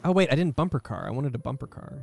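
ordinary delivery

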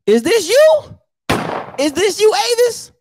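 mock disbelief. Real, not acted. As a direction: act stunned by the photo. Roasting a follower's photo.

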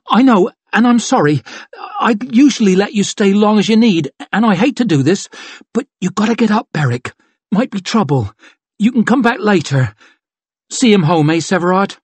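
kind firm thief; gently pressing him to go; apologetic but urgent. Acted. Chev urges Beric to leave.

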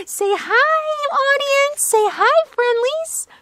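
excited puppy talk. Real, not acted. Owner urging her puppy to greet viewers, high and excited. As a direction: gasp and gush at the puppy.

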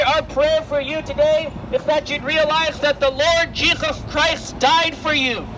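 ordinary talk, nothing marked

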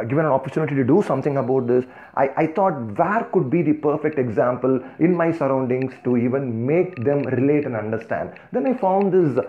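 ordinary talk

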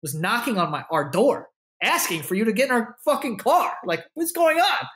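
dad builds from calm to a high outraged question; rising outrage. Retold or imitated. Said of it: "He's like".

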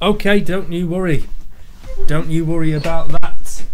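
loud reassurance. Acted. Hamming up holding the fort.

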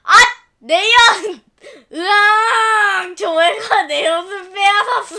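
loud, slow howling sob; boy wailing that his taffy was taken; child bawling. Acted. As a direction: bawl like a robbed kid. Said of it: "집이 떠나가라 큰 소리로 울어댔어요".

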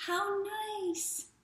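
delighted little owl. Acted. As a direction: gasp with pleasure at the picture.

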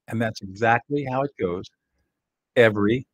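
ordinary delivery

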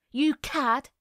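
restrained narrator's shout; shouted insult. A narrator's reading. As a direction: hurl it as an angry accusation. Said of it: "she shouted".

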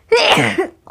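sudden loud sneeze; a real sneeze; sneezing. Real, not acted.

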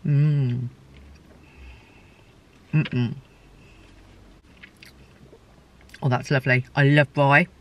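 talking while chewing food; muffled through a mouthful; mouth full, chewing. Real, not acted. Seen 0: Bites at -4 s; cheeks full, chewing through the labelled words.

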